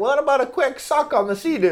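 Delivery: put-on high falsetto girlish come-on; high mock invitation on the Sea-Doo; mock girl's proposition.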